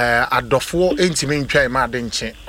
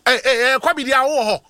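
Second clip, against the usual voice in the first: high, excited disbelief; surprised; 10 semitones higher, 3 dB louder than usual. Real, not acted. Host asking the caller in disbelief if Kobe is there, pitched high and excited. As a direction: jump up in surprise.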